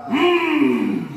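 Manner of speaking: high loud hmm to end the yell; loud group hum. Shown as a demonstration. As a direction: bellow the hmm as a cheer finale. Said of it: "Seperti itu ya". (hmm keras dan tinggi)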